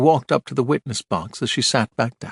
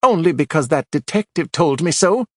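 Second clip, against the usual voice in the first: sharp, higher retort; snapped; 7 semitones higher, 4 dB louder than usual. A narrator's reading. Widow snapping at Archer under cross-examination, sharp. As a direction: snap back at him. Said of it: "she snapped".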